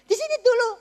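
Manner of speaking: loud, high command; imitated doctor's order. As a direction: call out to keep the patient put. Doctor ordering patient to stay.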